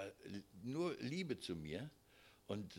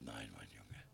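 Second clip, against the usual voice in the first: dying father's voice; 13 semitones lower, 10 dB quieter than usual. Retold or imitated. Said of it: "Und er sagt".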